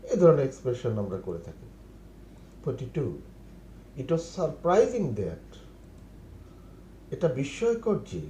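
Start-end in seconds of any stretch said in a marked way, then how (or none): 4.0-5.5 very slow, each word laid out for learners; slow model phrase. Shown as a demonstration. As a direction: spell it out slowly for the class. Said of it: "teacher models English phrase". (ধীরে ধীরে শেখানোর ভঙ্গিতে)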